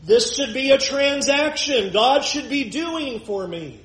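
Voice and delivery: a hint of a proud, slow Pharisee; lightly voiced smug Pharisee; Pharisee's claim. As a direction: lay out good deeds, slowly and smugly. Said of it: "Do you hear the Pharisee?".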